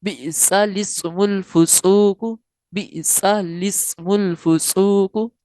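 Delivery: बिसा लिस्मुल फुसूक with लाम under jer, alifs dropped, slow; correct recitation. Shown as a demonstration. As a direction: join बिसा into लिस्मुल smoothly. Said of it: "इस तरह पढ़ना है।". (लाम को जेर देकर बिसा लिस्मुल फुसूक)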